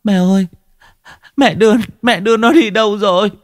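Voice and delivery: high, whimpering plea; a mother begging for her taken child, high and tearful; tearful pleading. Acted. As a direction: cry out to the mother-in-law for the baby. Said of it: "mếu máo".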